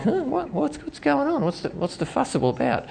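put-on high, soft puzzled little girl; toddler voice. Toddler puzzled why her parents are upset, high and soft. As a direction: play the carefree two-year-old. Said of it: "Jaya is just like".

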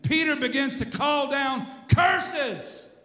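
high shouted denial, drawn out at the end; loud, soaring pulpit storytelling; full-force preaching. Acted. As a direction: act out Peter's furious cursing. Preaches Peter's cursing at full force.

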